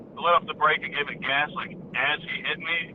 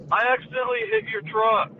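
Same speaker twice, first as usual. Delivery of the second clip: lightly voiced kid, pitched higher; 6 semitones higher than usual. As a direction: imitate a kid timidly addressing an adult, higher voice. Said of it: "this kid walks up to me".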